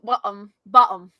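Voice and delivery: "bottom" with the T broken off; dropped T. Shown as a demonstration. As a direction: swallow the T in "bottom". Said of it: "Breaking the other T".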